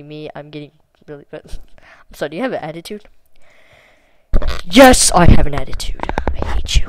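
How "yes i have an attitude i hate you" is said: loud, defiant reply; loud, bratty retort; loud sass. Real, not acted. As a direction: throw the attitude back loud. Reading bot's sassy reply.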